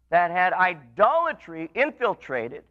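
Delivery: ordinary delivery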